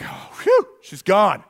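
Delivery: mock relief. Relief after hiding behind trees.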